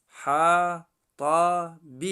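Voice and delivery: slow, loud spelled-out حطب; syllable by syllable. Shown as a demonstration. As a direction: stretch each syllable of حطب firmly.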